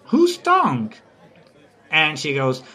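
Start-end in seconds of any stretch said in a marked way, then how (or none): 0.1-0.9 high-pitched mock query; lightly acted question, pitched well up; Petruchio. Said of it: "And Petruchio asks".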